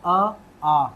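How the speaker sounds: short अ, long आ, short ई then long ई; teacher models Hindi vowel lengths; vowel recitation. Shown as a demonstration. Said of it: "ध्वनि पे खास तौर पे ध्यान दीजिएगा".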